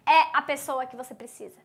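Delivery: ordinary talk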